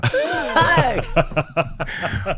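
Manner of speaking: high-pitched burst of laughter; laughing. Real, not acted. Father telling of his son's cheeky retort, laughing. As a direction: crack up in a long, high laugh.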